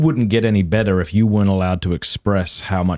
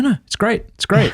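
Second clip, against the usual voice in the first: high-pitched imitation of someone insisting; mimicking breezy denial, pitched right up; mock reassurance; 13 semitones higher than usual. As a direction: wave it away in a much higher voice. Mimics partner insisting all is fine.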